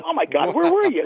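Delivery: high-pitched astonished question; mildly acted astonishment, pitched well up; retold amazement. Skiff owner retells reacting to a customer's catch, amazed.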